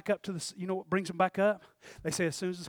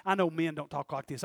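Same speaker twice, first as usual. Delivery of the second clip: ordinary delivery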